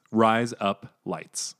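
"Rise Up Lights" run together slowly so it sounds like "razor blades"; "Rise Up Lights" blended into "razor blades", Aussie style; Australian accent. Shown as a demonstration. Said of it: "It's like saying razor blades".

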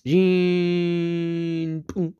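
high, long-held ringing noise; drawn-out high hum ending in a pop; imitated ringing boom. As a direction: make a sustained singing-bowl ring. Imitates welding and singing bowl.

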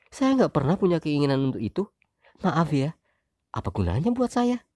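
high, fast wife voice, dismissive. Acted. Wife denying to husband, high fast voice. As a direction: brush it off as the wife.

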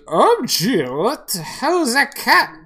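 put-on loud, high, stumbling drunken complaint; drunk man. Drunk man back from chasing the cat, loudly bewildered. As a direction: whine loudly like a drunk, words dragging. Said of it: "the man comes back and goes".

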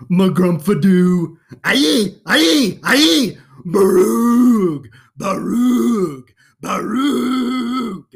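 loud, high nonsense babble, slow at the start; over-the-top nonsense sounds for a kid; silly sounds. Acted. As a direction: go full goofy on the gibberish. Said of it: "make silly sounds".

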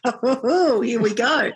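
mock "uh-oh" through laughter; laughing dread. Laughing at retold awkward turn.

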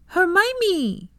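put-on high, loud little boy calling out a name; delighted child yelling hello; excited child's call. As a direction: shout her name like a thrilled six-year-old. Child runs to greet her.

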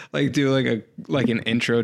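ordinary talk

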